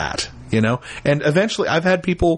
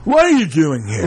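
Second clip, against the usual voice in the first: put-on gruff, louder challenge from a drunk ranger; 4 dB louder than usual. Ranger on the road challenges the players, gruff and loud. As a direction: call out at the stranger like a suspicious ranger.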